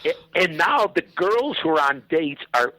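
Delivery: ordinary talk, nothing marked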